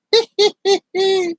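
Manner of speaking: high-pitched tittering laugh; high giggling cackle. Real, not acted. Laughing at the peanut trick on kids, high cackle. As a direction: giggle in high, quick bursts.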